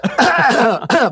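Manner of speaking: loud, high throat clearing. Real, not acted. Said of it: "I'm losing my voice".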